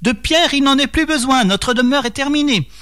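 high-pitched calling out across a distance; narrated call, pitched high and sustained without extra volume; cri lancé au loin. A narrator's reading. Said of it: "qu'on leur criait par-delà la forêt". (appel crié d'une voix haut perchée)

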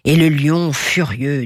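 ordinary delivery, nothing marked

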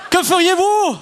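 interpellation pressante. Shown as a demonstration. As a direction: question lancée bien plus haut. Question relancée avec urgence. (question pressante, voix haut perchée)

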